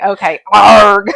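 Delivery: slow, loud pirate "arg"; pirate growl. Retold or imitated. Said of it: "I read it like a pirate".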